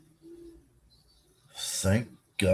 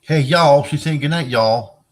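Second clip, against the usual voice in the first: loud call out; 7 semitones higher, 8 dB louder, slower than usual. Real, not acted. Relaying cohost's goodnight loudly.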